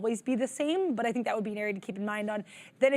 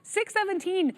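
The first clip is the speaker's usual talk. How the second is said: higher, louder excited rush; excited exclaiming; 5 semitones higher, 3 dB louder than usual. Real, not acted. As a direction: get hyped over the chart. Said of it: "so I stop sounding like an auctioneer".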